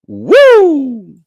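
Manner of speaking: loud high cheering woo; high whoop. Real, not acted. Celebrating his own joke with a loud woo. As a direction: whoop in delight.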